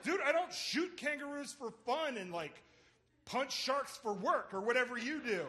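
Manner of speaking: exasperated, defensive comeback; snapping back. Retold or imitated. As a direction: argue back as if fed up. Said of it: "that's when I snapped".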